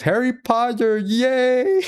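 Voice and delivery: high, dopey mock cheer; stupid voice. Podcaster imitating Sister Sage after the blast, stupid voice. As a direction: squeal it like a delighted dimwit, in a put-on voice. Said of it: "like, stupid voice when they're playing games".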